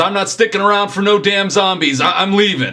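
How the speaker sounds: high, loud, stammering refusal; agitated chef. Acted. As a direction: protest loudly as a rattled man leaving. Acted chef refusing and fleeing.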